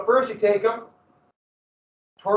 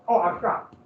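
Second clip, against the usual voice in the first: ordinary delivery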